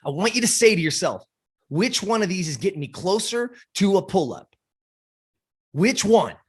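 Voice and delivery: high, driving pep talk; intense coach. Retold or imitated. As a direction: fire her up with conviction. Coach pushing her hard.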